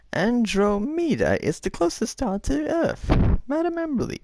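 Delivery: very high-pitched squirrel quiz host; squeaky little squirrel character; squirrely voice. Acted. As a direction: read it out like an excitable squirrel. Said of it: "That was my squirrely voice".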